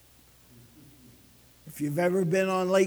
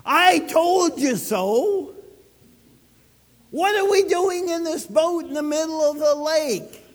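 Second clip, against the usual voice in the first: retold wife's complaint; 6 semitones higher, 4 dB louder than usual. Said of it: "Ellen would go".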